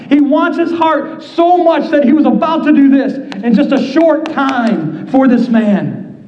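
ordinary talk, nothing marked

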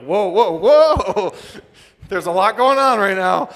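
loud, high-pitched alarmed whoas; overwhelmed shout. Acted. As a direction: yell it like it's all too much to take. Acts out a startled onlooker.

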